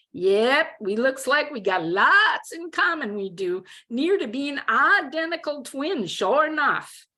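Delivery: high, hammed-up Texas twang; exaggerated Texas drawl. A narrator's reading. Roommate mocking what they have in common, exaggerated Texas drawl. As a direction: lay on a good-old-boy Texas drawl. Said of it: "put on an exaggerated Texas drawl".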